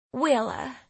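high, twangy Southern voice; Southern accent. A narrator's reading. Tearful girl introduces herself, high Southern accent. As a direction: give the name with a Southern twang. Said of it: "her voice twangy with a southern accent".

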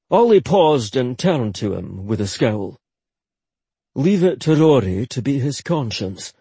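usual speaking voice

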